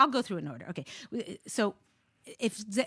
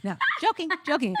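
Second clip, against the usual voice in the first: laughing it off, high and loud; host backing off her joke, laughing; 8 semitones higher, 8 dB louder, faster than usual. Real, not acted. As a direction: giggle through the words. Laughs after her own joke.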